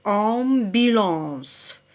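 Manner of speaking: "ambulance" stretched very slowly, each syllable held; drawn-out syllables. Shown as a demonstration. Teacher to pupils, reading the homework word very slowly. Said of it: "Il vous reste".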